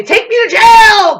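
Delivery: high, loud, slow shout; mock shout. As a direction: yell it to get arrested. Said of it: "I'll say".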